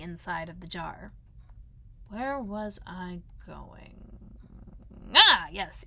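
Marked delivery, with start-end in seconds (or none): 2.1-4.3 very slow, drawn-out pondering; dreamy musing. Acted. Pooh wonders to himself after the honey, slowly and dreamily. 5.1-5.8 loud "Ah" of realisation; sudden remembering. Acted. Pooh recalls his errand, with a loud sudden realisation.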